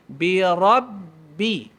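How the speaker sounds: Bi rabbi very slowly, pressing the doubled b; pressed syaddah. Shown as a demonstration. Teacher demonstrates the held syaddah on rabbi. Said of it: "Syaddah maksudnya tekan".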